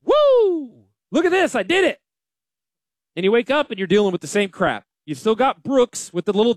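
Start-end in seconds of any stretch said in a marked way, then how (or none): 0.0-2.0 mock cheer. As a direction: whoop it up like you just won. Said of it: "It's like".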